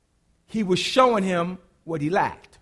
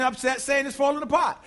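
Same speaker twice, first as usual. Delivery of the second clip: normal talking voice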